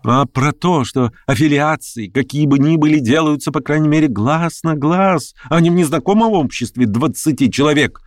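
mildly heated retort; blurted retort. A narrator's reading. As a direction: blurt out the accusation. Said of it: "брякнул Хромой".

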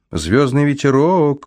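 high, slow call after someone; shouting after her. A narrator's reading. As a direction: call her name after her as she flies off. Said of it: "крикнул я вдогонку".

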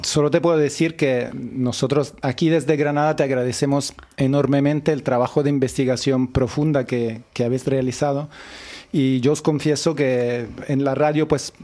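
ordinary talk, nothing marked

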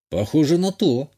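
plain reading voice

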